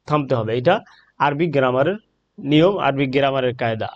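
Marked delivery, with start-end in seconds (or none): none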